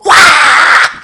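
high shouted demon wail; high screaming demon cry; demon shriek. Retold or imitated. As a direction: let out a piercing demon scream. Demons shriek at Jesus' name.

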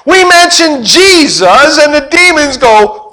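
high, slow, amazed voice of the returning disciples; wide-eyed disciples, high and loud; excited disciples. Retold or imitated. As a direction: marvel out loud, almost shouting. Disciples' excited report, demons shriek.

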